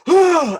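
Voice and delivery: loud, high gasp of fright; panicked yelp. Retold or imitated. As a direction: re-enact a heart-pounding shock. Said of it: "And my heart's like this".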